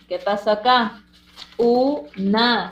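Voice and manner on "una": "Una" split as u, na with a gap; syllable by syllable. Shown as a demonstration. Teacher correcting pupils' split, "Una" in two separated syllables. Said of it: "se separa así".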